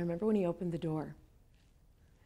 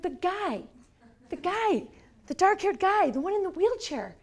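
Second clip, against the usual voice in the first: excited describing; 10 semitones higher, 6 dB louder than usual. Retold or imitated. Describing the man to boss.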